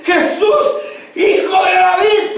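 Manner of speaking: high, ringing imitated call; retold shout. Blind man hears Jesus is passing, imitated high shout. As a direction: cry out to catch a passerby's attention. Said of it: "pero él más gritaba".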